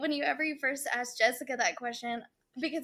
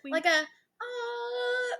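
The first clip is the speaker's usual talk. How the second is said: sung high twinkle sound; twinkly magic sparkle; 9 semitones higher, slower than usual. Shown as a demonstration. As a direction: chime it like a little sparkle. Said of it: "that little twinkle sound".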